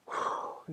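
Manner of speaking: long breathy sigh of relief; demo sigh. Shown as a demonstration. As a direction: let out a big relieved sigh. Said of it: "a sigh of like".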